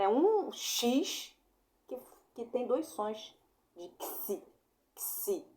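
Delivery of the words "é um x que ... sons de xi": "xi" said slowly and clearly, the X sound stretched; sound demo. Shown as a demonstration. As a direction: model the "xi" sound for students. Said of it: "ó, tá vendo?". ("xi" pronunciado devagar e bem claro)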